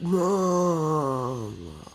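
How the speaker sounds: weak crying call; crying out for mom. Retold or imitated. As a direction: call for your mother, tearful. Waking from coma.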